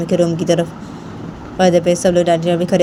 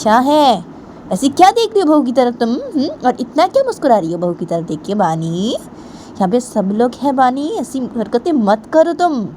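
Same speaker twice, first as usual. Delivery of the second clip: put-on higher, stern stepmother voice scolding the girl; a higher, scolding older woman; 6 semitones higher than usual. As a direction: tell her off at the table, drawn out. Stepmother scolds Bani at dinner.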